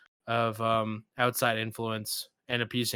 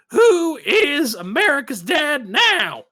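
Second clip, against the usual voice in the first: much higher and louder, slow taunting shout; Eddie Murphy shout; 13 semitones higher, 8 dB louder, slower than usual. Retold or imitated. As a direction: yell it as a gloating comedian. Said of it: "Eddie Murphy being like".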